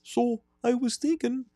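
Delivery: put-on high-pitched Wisconsin hockey accent; squeaky high Wisconsin killer calling his mother; Ed Gein voice. Caller doing his killer voice to mother. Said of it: "my Ed Gein voice".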